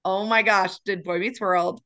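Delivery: usual speaking voice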